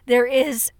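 hoarse, strained voice that keeps catching; losing voice. Real, not acted. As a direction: speak with a voice that's going hoarse. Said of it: "sorry, I'm losing my voice again".

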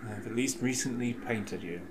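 ordinary talk, nothing marked

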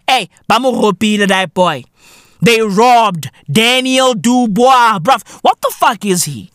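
ordinary talk

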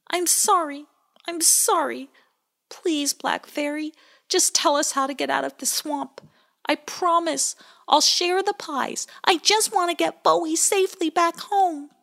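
high, earnest pleading voice; a sorry child begging the fairy; high loud apology; Pie Jam begging. A narrator's reading.

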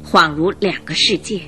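ordinary delivery

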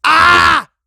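loud, high comic scream; comic-book scream. Shown as a demonstration. Showing listeners an onomatopoeia, a loud comic scream. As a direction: belt it out like a cartoon panel. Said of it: "para expresar sonidos como".

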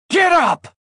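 furious yelled order; screaming. Acted.

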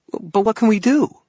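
very high, loud anxious question; panicky young man. A narrator's reading. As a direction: let the voice jump up in panic. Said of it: "echoed in a panicky tone".

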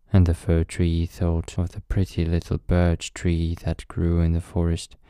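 ordinary delivery

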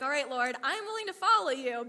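loud, acted give-in before an objection; loud protest to God. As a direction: call it up to the Lord, loud. Acted reluctant reply to God.

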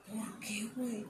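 a confused little whisper. Retold or imitated. Asking the coworker why, in a whisper. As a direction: whisper it, puzzled. Retold puzzled reply to coworker.

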